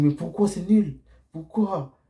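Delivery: high mock girl's voice asking why; puzzled date. Woman on a date asks why it's lame, high and smiling. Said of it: "avec un sourire".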